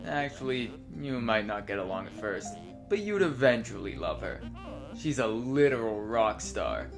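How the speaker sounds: softly reading dialogue lines aloud; reading game dialogue. Acted. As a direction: read the lines like a script. Reads both characters' dialogue.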